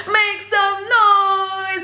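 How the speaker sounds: high, drawn-out stage call; mock concert shout at moderate volume; imitated hype call. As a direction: mimic a performer rousing the crowd, stretched out high. Imitates idol hyping the crowd.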